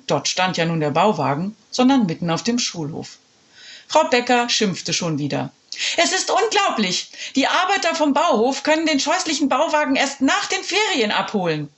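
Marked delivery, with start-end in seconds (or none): 6.0-11.7 high, indignant headmistress voice; scolding principal. Acted. Principal complaining about the trailer, high and indignant. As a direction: huff it out like an outraged principal.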